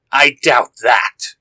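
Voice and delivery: menacing beast, slow and low; fox monster voice. A narrator's reading. Fox monster answers the hero.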